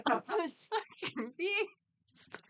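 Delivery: giggling about the mix-up; laughing. Real, not acted. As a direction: talk through laughter, slow and high.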